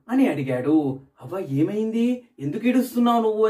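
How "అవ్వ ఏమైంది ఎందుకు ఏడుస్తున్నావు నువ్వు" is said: put-on higher, quick, worried child's question; a lightly voiced worried boy asking why she cries; worried little boy. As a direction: ask the old woman gently but urgently. Boy asks crying old woman again.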